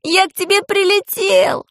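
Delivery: put-on high, slow boyish call from afar; elated high holler; joyful shout. A narrator's reading. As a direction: cry it out high, stretching the words. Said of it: "закричал Пашка".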